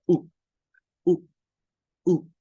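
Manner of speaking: U cut very short, as in book; quick little bursts of U, spaced apart. Shown as a demonstration. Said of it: "Esta es una U corta".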